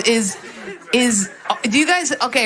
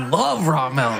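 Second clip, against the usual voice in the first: mock deep voice; 9 semitones lower, slower than usual. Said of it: "I was like".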